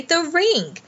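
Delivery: high, eager exclamation; excited cry. A narrator's reading. Boy pointing out the ring to the girl, excited. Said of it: "cried Diggory".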